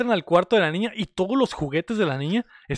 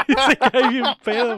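higher, louder, laughing through it; laughing retelling; 6 semitones higher, 5 dB louder than usual. Retold or imitated. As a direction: crack up while telling the fall. Retelling a drunk fall, laughing.

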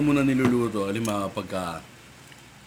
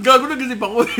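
laughing, much higher and louder; 10 semitones higher, 10 dB louder, faster than usual. Real, not acted. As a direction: burst out laughing as you say it. Laughing after teasing.

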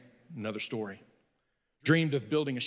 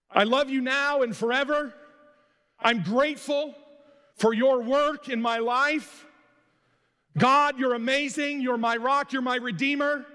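higher, louder, gushing praise to God; fervent prayer; 6 semitones higher, 4 dB louder than usual. Acted. Believer praising God with fervent gushing devotion. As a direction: pour it out like an eager worshipper.

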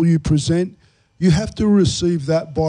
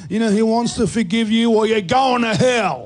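higher, lightly hammed soapbox preaching; street preacher voice; 6 semitones higher than usual. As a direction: mimic a street corner preacher. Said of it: "preconceived idea of standing on a soapbox".

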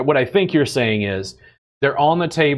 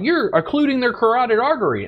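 high prissy lecturing, much higher; mocking critics; 11 semitones higher, faster than usual. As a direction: parody a fussy know-it-all. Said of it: "They're like".

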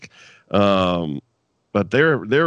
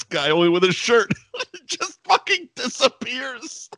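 ordinary talk

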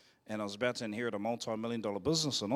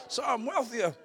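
high, loud, cracking up; laughing; 10 semitones higher, 5 dB louder than usual. Real, not acted. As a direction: squeeze the words out between laughs. Repeats joke between laughs.

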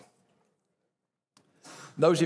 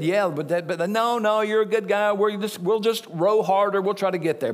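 kindly sailors; 5 semitones higher than usual. Pretend. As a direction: play the sailors being generous. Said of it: "they could have said".

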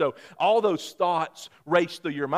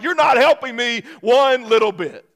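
annoyed wife; 8 semitones higher, 10 dB louder than usual. Retold or imitated. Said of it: "she's like".

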